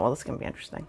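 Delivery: almost whispered, dry and doubtful; hushed inner thought. Retold or imitated. Watching the fishing pole girl, a hushed doubtful aside. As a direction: breathe it like a private wry thought. Private skeptical thought at post office.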